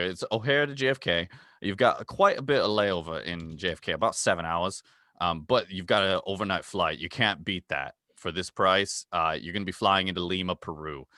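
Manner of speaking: hammed-up Australian travel host; Aussie accent. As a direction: play the jolly Aussie guide with plenty of mate. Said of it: "with your accent".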